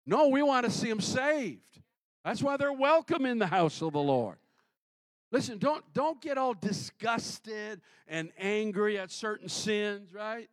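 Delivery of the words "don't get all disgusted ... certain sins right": ordinary talk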